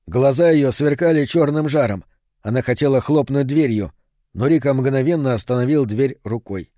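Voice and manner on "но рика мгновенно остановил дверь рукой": plain reading voice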